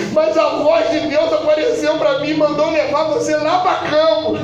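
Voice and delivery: high, driving revival preacher delivery; full-force preaching. Real, not acted. Preacher testifying to the church about the pastor, full-force preaching.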